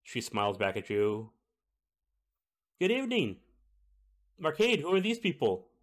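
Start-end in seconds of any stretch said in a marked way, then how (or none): 2.8-5.7 high-pitched middle-aged woman; high, questioning kitchen-cook voice; cook character voice. Acted.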